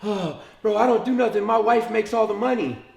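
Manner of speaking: high, loud, innocent little voice; hammed-up naive act; playing dumb. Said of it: "and I'm playing dumb".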